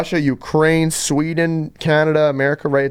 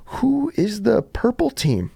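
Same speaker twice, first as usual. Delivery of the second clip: slow, higher-pitched quote of a naive question; clueless question; 8 semitones higher, slower than usual. Retold or imitated. Teammate asking on the bus during the game, clueless question. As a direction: lightly imitate a slow, puzzled asker. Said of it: "and he goes".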